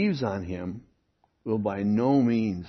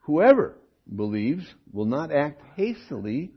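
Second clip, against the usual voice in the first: usual speaking voice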